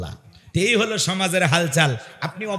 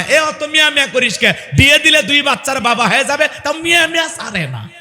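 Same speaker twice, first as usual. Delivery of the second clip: higher, louder annoyed scolding; irritated mother; 7 semitones higher, 4 dB louder than usual. Retold or imitated. Mother scolding the child who keeps calling her, annoyed. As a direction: snap at the child to stop calling. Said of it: "রাগান্বিত হয়ে যায়".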